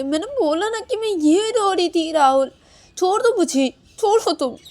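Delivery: high, loud insistence; exasperated protest. Acted. Acted quarrel, demands release. (झुंझलाकर ऊँची, तेज़ आवाज़ में विरोध)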